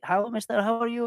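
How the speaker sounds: high-pitched greeting, imitating a villager; friendly Indonesian local. Locals hailing a foreigner walking down the street, imitated high friendly call. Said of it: "people be like".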